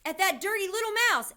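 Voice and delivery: high, very loud cry of alarm; alarmed yell. A narrator's reading. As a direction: yell out the sighting to everyone. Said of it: "I heard a man's voice yelling".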